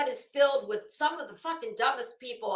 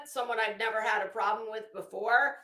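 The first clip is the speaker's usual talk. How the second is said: usual speaking voice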